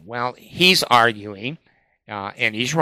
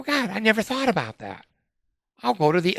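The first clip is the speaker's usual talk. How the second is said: re-enacted high-pitched astonishment; sudden realization; 9 semitones higher, faster than usual. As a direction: play the idea dawning, voice jumping high. Acting out his sudden realization.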